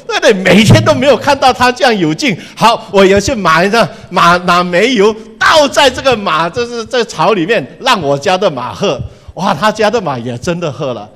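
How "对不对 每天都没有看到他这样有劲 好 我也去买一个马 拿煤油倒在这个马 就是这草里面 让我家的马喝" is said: higher and louder, a lightly voiced envious neighbor; eager neighbor. Neighbor talking himself into buying a horse, eager and louder. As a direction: hurry him along, keen to copy. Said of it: "这个人听了之后就赶快回家说".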